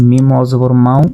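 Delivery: ordinary talk, nothing marked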